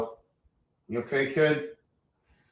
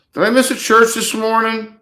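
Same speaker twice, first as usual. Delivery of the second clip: higher and louder, exasperated; higher, louder disbelief; dismayed surprise; 9 semitones higher, 9 dB louder than usual. Real, not acted. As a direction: blurt it out in disbelief. Annoyed at missing stream.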